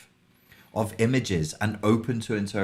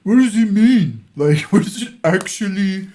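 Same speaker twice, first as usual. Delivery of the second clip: much higher and louder, exasperated; mock literal-minded demand; 10 semitones higher, 10 dB louder than usual. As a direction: demand the meaning like a baffled literalist. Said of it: "get all literal and two-dimensional and be like".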